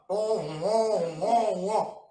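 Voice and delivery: loud, drawn-out wailing oh like a siren; mock submarine alarm. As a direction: wail it like a submarine horn.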